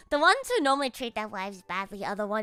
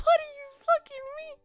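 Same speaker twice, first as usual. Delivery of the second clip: high disbelieving shout. Retold or imitated. Streamer retelling her shocked reaction, shouting high. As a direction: yell it in amused disbelief. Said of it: "I was like".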